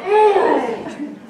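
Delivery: amused, caught-out hum; grudging laughing hum. Retold or imitated. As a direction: concede the point with a laugh. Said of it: "And you're like".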